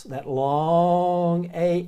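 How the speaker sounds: long A stretched very slowly; drawn-out long A. Shown as a demonstration. Teacher demonstrating the long A sound, drawn out. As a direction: hold the long A out. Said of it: "the Greek word is Elias".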